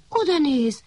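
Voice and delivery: ordinary delivery, nothing marked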